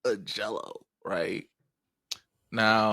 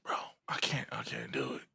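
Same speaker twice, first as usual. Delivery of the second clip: hushed, voiceless giving up; very soft whispered surrender, acted out; defeated whisper; 6 semitones lower, 14 dB quieter than usual. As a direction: whisper it as if beaten.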